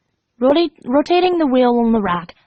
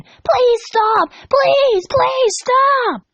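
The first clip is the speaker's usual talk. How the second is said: much higher, sobbing begging; crying pleas; 14 semitones higher, much slower than usual. Acted. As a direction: plead through sobs. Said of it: "Dash burst out crying again".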